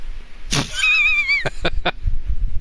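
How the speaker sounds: huge shrieking laugh; cracking up at the jump. Real, not acted. Streamer cracking up at a friend's wild jump, shrieking laugh.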